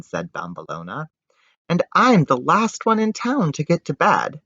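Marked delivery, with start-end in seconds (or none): 1.7-4.4 put-on girl protesting. Daughter protests.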